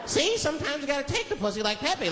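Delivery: put-on high-pitched gleeful little boy; nephew's voice. Nephew learning from Pepe cartoon, high child voice. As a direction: pipe it up like a delighted kid. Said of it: "My nephew was sitting there".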